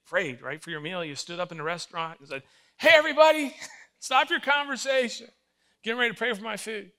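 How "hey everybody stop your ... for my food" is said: loud, high mock announcement to all; calling out to a room. Diner standing up to address the whole restaurant, loud call-out. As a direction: holler it out to get everybody's attention.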